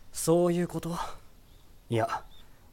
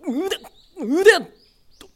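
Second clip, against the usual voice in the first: high, rising to a loud yelp; horrified shout; alarmed cry; 4 dB louder, slower than usual. Acted. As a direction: panic at the sight. Sees her broken arm.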